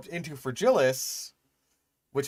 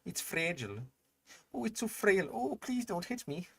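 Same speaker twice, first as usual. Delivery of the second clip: frail creature voice; 10 semitones higher than usual. Retold or imitated. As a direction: whimper like a fragile little thing, then perk up. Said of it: "Fragilis is just like".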